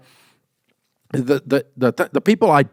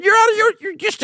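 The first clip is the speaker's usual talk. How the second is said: high strained yell that breaks off; sputtering frustration; faster than usual. Retold or imitated. Frustrated boss losing it at staff, sputtering high yell. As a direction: splutter in rising anger, words failing. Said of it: "I was frustrated and going".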